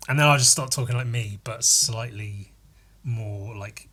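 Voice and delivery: ordinary talk, nothing marked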